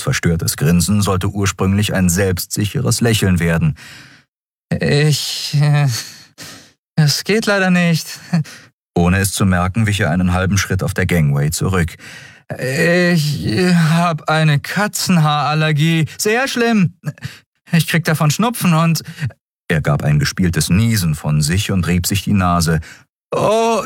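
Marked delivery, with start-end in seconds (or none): 4.7-8.2 put-on much higher, faltering boy's voice; stalling for an excuse; hesitant Miko. Miko backing out of the fight, hesitant. 12.6-19.0 much higher, slow at first, overacted; a bad liar; fake excuse. Miko inventing a cat allergy, high and overacted.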